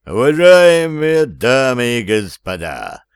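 booming ringmaster, slow and loud; announcer voice. Acted. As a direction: announce it grandly to the hall. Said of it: "Голосом конферансье начал он".

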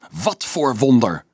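slow, scornful flare-up; flaring up. A narrator's reading. Boy bristling at the promised miracle, scornful. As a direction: challenge him with disbelief. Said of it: "stoof Dolf op".

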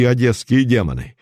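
ordinary delivery, nothing marked

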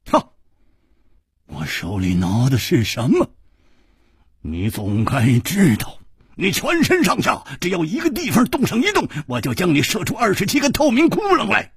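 menacing threat building to high pitch; harsh warning that climbs and speeds up at the end; stern. A narrator's reading. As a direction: start measured, then threaten sharply and high. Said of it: "厉声说".

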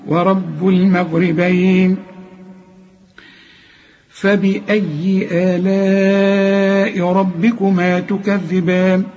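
slow melodic tajweed chant; Quran recitation. Shown as a demonstration. Teacher recites the verse for students, slow melodic chant. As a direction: chant the verse slowly, drawing out the vowels. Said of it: "هنقول".

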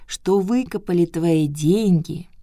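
put-on slow, hushed old woman; old turtle voice. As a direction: tell it slowly like a granny. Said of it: "сказала черепаха".

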